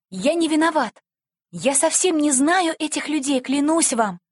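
high, earnest imploring; pleading. A narrator's reading. Boy swearing innocence to the policeman, pleading. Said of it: "взмолился Франческо".

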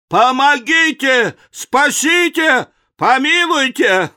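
high, urgent pleading cries; cries for help. A narrator's reading. Fleeing passengers beg to be saved, crying out. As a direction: call out in storybook panic. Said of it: "все кричат".

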